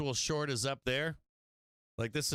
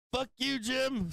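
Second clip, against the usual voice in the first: mock angry seller; 11 semitones higher, slower than usual. Imagined angry seller quote.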